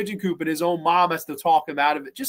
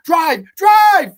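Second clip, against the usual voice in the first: high, very loud acted yell; urgent getaway shout; 11 semitones higher, 12 dB louder than usual. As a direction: imitate a frantic scream to flee. Urging getaway from attackers.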